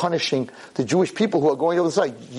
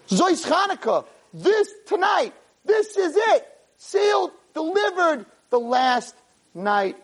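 fiery preaching; 14 semitones higher, slower than usual. Acted. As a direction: preach it with full fire. Rabbi preaches at full force.

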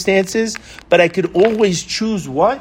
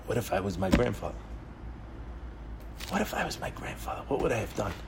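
very soft, quick musing; hushed self-questioning; 4 semitones lower, 14 dB quieter, faster than usual. Acted. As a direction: ask yourself almost under your breath. Models a private daily reflection.

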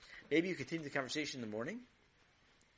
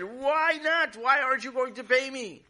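high, loud indignant cry; upset grown-up demanding payment; outraged neighbor; 11 semitones higher, 9 dB louder than usual. Acted. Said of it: "And Mister Smith said".

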